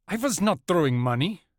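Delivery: lightly voiced German accent, puzzled; clipped denial in a German accent; a hint of German accent. A narrator's reading. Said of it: "He had a German accent".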